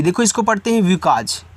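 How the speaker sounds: usual speaking voice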